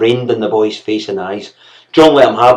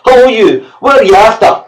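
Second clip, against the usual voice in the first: put-on high, loud bawling child; bawled; 11 semitones higher, 7 dB louder than usual. A narrator's reading. Boy bawling cheekily at the servant. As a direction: yell it at the top of your lungs. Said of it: "bawled the boy".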